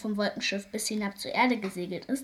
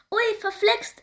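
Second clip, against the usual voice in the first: high, loud dismayed wail; 11 semitones higher, 5 dB louder, slower than usual. A narrator's reading. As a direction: cry out in dismay as he tumbles. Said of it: "jammert Engel Malfriede".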